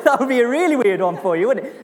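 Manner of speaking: high, loud amused aside; laughing. Real, not acted. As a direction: say it quickly through laughter. Laughing at own spouse joke.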